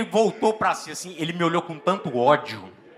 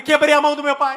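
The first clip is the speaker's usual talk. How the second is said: panicked confession; 7 semitones higher, 6 dB louder than usual. Retold or imitated. Repeating confession to mom.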